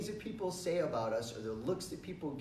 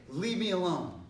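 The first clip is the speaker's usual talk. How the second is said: firm, higher-pitched direct command; stronger voice; 4 semitones higher, 4 dB louder than usual. Shown as a demonstration. Teacher leads class in telling off a pest, strong voice. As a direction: order them off with force. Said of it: "now with a stronger voice".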